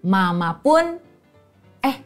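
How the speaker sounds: ordinary delivery, nothing marked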